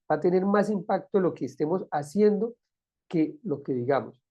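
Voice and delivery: normal talking voice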